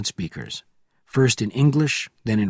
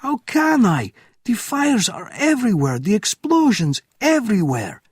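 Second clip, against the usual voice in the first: very high, loud cry; desperate protest; 11 semitones higher, 4 dB louder than usual. Acted. Officer protesting to Bourne, very high and loud. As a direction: plead helplessly.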